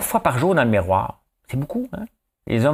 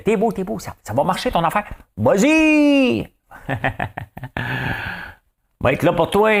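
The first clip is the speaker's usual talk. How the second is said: flattering cheerleader; 10 semitones higher than usual. Retold or imitated. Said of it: "Si tout le monde te disait".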